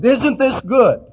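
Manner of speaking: hammed-up proud host fishing for praise. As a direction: drawl it out like you're showing off your cooking. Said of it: "Friends come over and you say".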